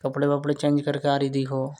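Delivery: usual speaking voice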